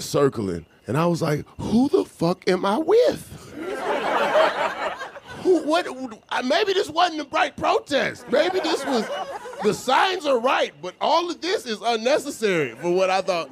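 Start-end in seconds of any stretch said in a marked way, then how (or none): 1.6-13.5 high, shrill-pitched disbelief with laughter; imitating his own alarmed self; mock panic. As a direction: freak out as the helicopter circles. Panic when helicopter arrives.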